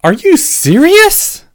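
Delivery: very high, loud, drawn-out disbelief; incredulous blurt. A narrator's reading. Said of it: "Harry said before he could stop himself".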